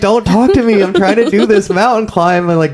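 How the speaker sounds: high, put-on annoyed voice; hammed-up snippy brush-off; imitating himself as a snippy hiker; mock rude brush-off. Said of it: "I'm like".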